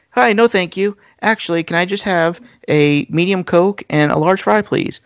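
match her bubbly energy and go even brighter. Retold or imitated. Speaker orders at the drive-thru in a high, perky voice. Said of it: "I even talk even whiter than her".

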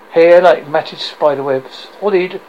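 ordinary delivery

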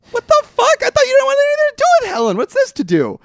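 high, loud and fast in disbelief; high incredulous rant. Real, not acted. Complaining to a friend about a character, high and fast. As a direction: squeal the outrage at him.

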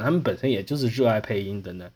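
ordinary talk

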